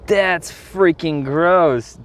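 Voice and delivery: slow, high, grossed out; disgusted. Real, not acted. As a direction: drag it out in disgust. Goo on his hands.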